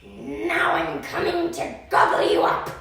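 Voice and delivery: loud menacing troll; troll snarling to gobble him up; troll threat. Acted. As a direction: roar the threat down at the goat.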